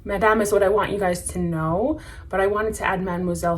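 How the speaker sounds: ordinary talk, nothing marked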